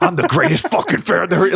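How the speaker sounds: high, puffed-up mock fan boast; imitating a smug fan. Podcaster mocks a flattered fan, high puffed-up boast. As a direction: brag like the crowd's biggest fan. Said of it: "anybody's sitting in there like".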